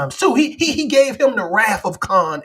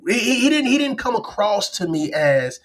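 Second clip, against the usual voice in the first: ordinary delivery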